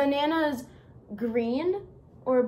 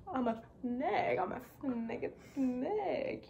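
put-on Southern accent; 6 dB quieter than usual. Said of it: "There we go, I'm talking".

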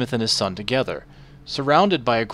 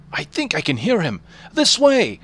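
rising to a high shout; excited blacksmith; 7 semitones higher than usual. Acted. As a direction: call urgently into the dark. Blacksmith hears his son.

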